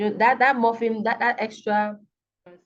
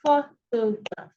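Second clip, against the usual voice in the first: photograph, slowly split into pho-to-graph; syllable by syllable; slower than usual. Shown as a demonstration. Teacher showing three syllables in a word, slow and separated. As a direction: pull photograph apart into three beats. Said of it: "this word has three syllables here".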